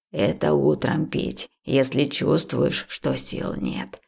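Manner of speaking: unhurried village healer's instruction; old herbalist. A narrator's reading. Old woman prescribes morning herbs, low and slow.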